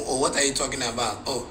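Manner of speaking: lightly voiced old man, low and soft; a hint of an offended elder muttering; vexed old man. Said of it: "then he vex".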